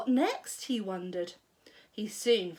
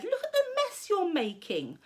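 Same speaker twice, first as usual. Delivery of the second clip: put-on higher boy's complaint; complaining boy; 8 semitones higher than usual. A narrator's reading. George complaining to Grandma. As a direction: scold her childishly.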